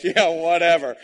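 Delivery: loud laughing "yeah, whatever"; laughing dismissal. Real, not acted. Pastor laughing at his own family joke, loud and amused.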